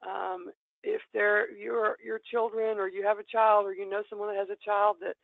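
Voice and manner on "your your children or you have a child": ordinary talk, nothing marked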